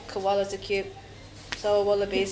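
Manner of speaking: a hint of a bored deadpan demon. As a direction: say it with zero excitement. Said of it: "deadpan voice".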